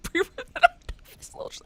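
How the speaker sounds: high, breathless words through laughter; can't get it out for giggling; laughing. Real, not acted. Streamer repeats the joke and cracks up laughing. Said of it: "I can't even say it without laughing".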